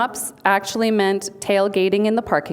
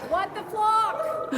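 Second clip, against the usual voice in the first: high, slow, drawn-out mock holler; hammed-up distant yell, pitched way up; 13 semitones higher, 6 dB quieter, much slower than usual. Speaker acting out the team's outburst, high mock yell. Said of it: "wanted to yell things out".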